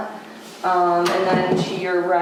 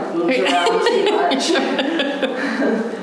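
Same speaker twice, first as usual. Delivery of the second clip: laughing; 5 semitones higher, much slower than usual. Real, not acted. Laughing about her mask.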